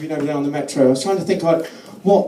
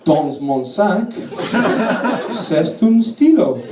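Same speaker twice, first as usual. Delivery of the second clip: put-on halting French in a learner's accent; tentative French small talk with rising ends; schoolboy French; 2 semitones higher, slower than usual. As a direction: grope for each French phrase like a struggling pupil. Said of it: "my G C S E in French".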